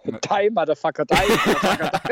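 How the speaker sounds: laughing. Acted. Laughing, mock song call.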